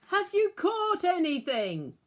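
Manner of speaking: a loud, high call across the water. A narrator's reading. Man on the beach calls to the fishermen, loud and high. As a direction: hail the boat from the shore. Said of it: "a man on the beach called out".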